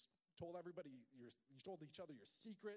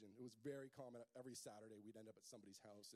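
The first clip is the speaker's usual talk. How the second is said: ordinary talk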